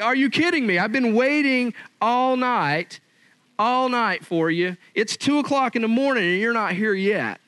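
hammed-up exasperated wife scolding; mock exasperated wife. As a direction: scold the late husband, half-playing. Upset wife quoted.

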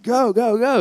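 half-hearted yell to get the donkey moving; urging the donkey on. Retold or imitated. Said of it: "He's like".

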